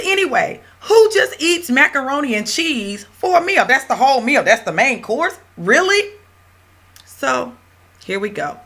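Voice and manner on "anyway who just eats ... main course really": much higher, laughing disbelief; incredulous. Retold or imitated. Teacher mocks her students' skit, incredulous and laughing. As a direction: scoff at the idea of mac and cheese as dinner.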